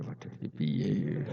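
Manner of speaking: a lightly voiced angel at a loss; mock worried mutter. Munkar Nakir wondering what to do, soft slow fretful mutter.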